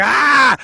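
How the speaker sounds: big shouted lion roar, high and loud. Acted. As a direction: let out a wild animal howl. Lion mating roar acted out.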